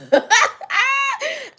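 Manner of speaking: very high teary laugh; squealing joyful laugh; high crying laugh. Real, not acted. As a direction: laugh through happy tears. Overjoyed laugh.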